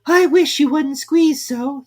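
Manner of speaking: high, slow squeaky complaint; a small creature squeezed and cross; high, slow, loud Dormouse grumble; Dormouse complaining. A narrator's reading. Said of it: "said the Dormouse".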